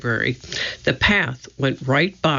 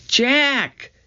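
calling out; 10 semitones higher than usual. A narrator's reading. Annie to her brother from the woods, calling out. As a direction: call the name with pitch way up. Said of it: "Annie called from the woods".

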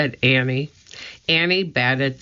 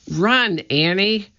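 higher, urgent cry without full shout; urgent, raised-pitch call; 6 semitones higher than usual. A narrator's reading. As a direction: cry out a warning. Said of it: "cried Jack".

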